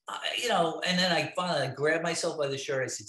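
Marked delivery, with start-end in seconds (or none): none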